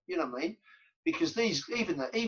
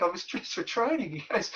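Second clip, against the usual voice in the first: ordinary delivery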